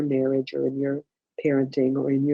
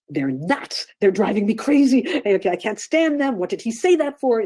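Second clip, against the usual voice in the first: higher, louder, exasperated complaining; mock venting rant; 6 semitones higher, 4 dB louder than usual. Shown as a demonstration. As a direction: play the person who rants about them, not the feeling. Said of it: "Please don't go into".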